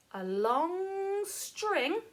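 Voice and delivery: very slow, stretched on "long", rising high; drawn out. Shown as a demonstration. Teacher asking children to imagine a long string, drawn out slowly.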